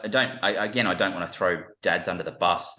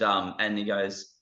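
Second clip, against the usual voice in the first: usual speaking voice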